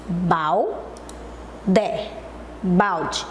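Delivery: "balde" broken as bal, long pause, de; low, separated beats on "balde"; split into syllables. Shown as a demonstration. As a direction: hold the two syllables of "balde" far apart. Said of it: "Como é que eu separo em sílabas".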